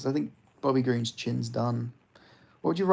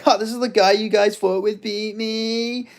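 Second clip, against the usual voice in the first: higher, slowed mock gloating voice; a smug champ sneering at his doubters; 8 semitones higher, 5 dB louder than usual. Streamer imitating Jones tweeting a smug gloat at fans. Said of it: "he's like".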